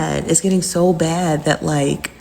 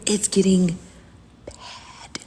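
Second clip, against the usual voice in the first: hushed whisper; 8 dB quieter, much slower than usual. Acted. Dropping voice on ominous news.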